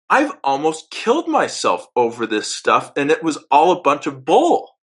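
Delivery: building fury, pitch climbing line by line; rising anger. Retold or imitated. Man realizing he was misled, rising in anger. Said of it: "intense anger".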